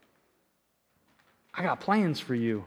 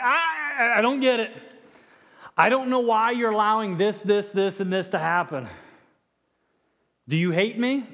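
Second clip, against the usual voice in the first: mock crying out; 4 semitones higher than usual. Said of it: "it's like I'm crying out like".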